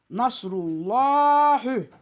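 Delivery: "Nasrullahi" chanted with a heavy, long "llaa"; thick lam recitation. Shown as a demonstration. Teacher demonstrating the rule, "Nasrullahi" with thick lam. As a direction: recite "Nasrullahi" slowly, lam full and dark. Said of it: "Kalın".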